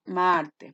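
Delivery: MAR in Martes stretched out; stress on first syllable. Shown as a demonstration. Teacher answering what day it is, MAR stressed in Martes. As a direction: lengthen and lean on MAR of Martes. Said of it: "¿Y dónde se carga la voz?".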